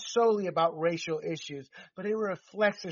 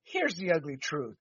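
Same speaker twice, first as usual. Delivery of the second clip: ordinary talk, nothing marked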